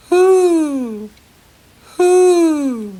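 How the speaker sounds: high, loud drawn-out hoo; owl hoot. Shown as a demonstration. Teacher voicing an owl for children, loud high hoots. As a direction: call out like an owl at night. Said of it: "Now you try".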